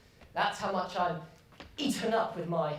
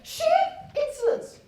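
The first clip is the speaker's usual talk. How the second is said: outraged shout; 13 semitones higher, 10 dB louder than usual. Acted. Indignant master complains.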